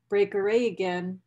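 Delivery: usual speaking voice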